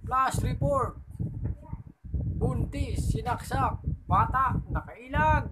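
high, punchy newscaster read; news report. Acted. Joke told as a news flash, high and punchy. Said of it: "Sabi sa balita".

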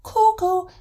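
high, loud cuckoo call; high-pitched mock birdcall; cuckoo cry. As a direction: imitate a cuckoo calling out. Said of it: "where I will cry".

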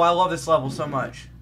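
ordinary talk

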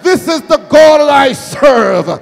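loud, high testimony building to a shouted climax; impassioned pulpit crescendo; full-force preaching. Real, not acted. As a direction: drive each phrase harder until it is a shout.

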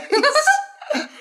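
high crazed laugh; short deranged cackle burst; manic laugh. Acted. Wojciech lacht im Wahn. (hohes irres Lachen)

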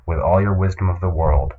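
ordinary talk

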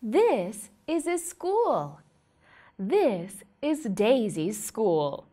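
put-on slow sing-song questions for a toddler; reading to a toddler. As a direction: ask it like a picture-book game. Quizzes toddler about pictures.

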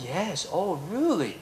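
softly voiced mock "oh really"; polite Englishman. Lecturer imitating the Brit feigning interest, soft and polite. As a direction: lightly act the polite, unconvinced Brit.

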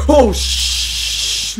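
loud, high cry cut into a long "sh"; startled outburst. Real, not acted. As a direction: burst out in shock, stopping the swear. Startled mid-sentence by the game.

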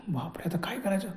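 hushed, breathless whisper of dread; a scared whisper to himself in the dark; frightened whisper. Retold or imitated. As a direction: whisper it as if the robbers might hear. Terrified man whispers to himself.

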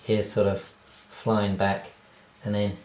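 normal talking voice

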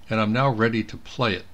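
normal talking voice

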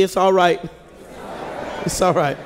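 calm a neighbor down gently; a reassuring pat in words; soothing reassurance. Shown as a demonstration. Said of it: "tap your neighbor on the shoulder and say".

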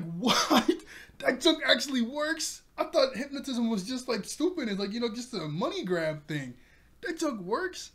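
stunned that the silly trick worked; shocked Nami. Retold or imitated. Nami shocked hypnotism works.

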